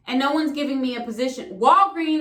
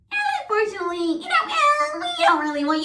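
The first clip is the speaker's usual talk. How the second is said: high, laughing fake-polite rejection; mocking email voice; 12 semitones higher than usual. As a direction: parody a corporate brush-off. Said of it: "the little cliche shit that they email you".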